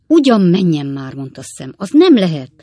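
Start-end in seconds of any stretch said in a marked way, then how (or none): 0.1-1.1 loud, scoffing disbelief; incredulous. A narrator's reading. Sam hearing money ruined the cobbler, incredulous. 1.8-2.5 loud, high-pitched disbelief; incredulous. A narrator's reading. Sam rejecting the cobbler's claim, incredulous.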